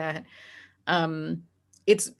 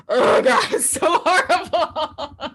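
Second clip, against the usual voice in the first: high, loud, slowed, laughing through it; laughing groan; 8 semitones higher, 7 dB louder, slower than usual. Real, not acted. Recalling the episode's plot to her cohost, groaning with laughter. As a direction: cringe and laugh at once.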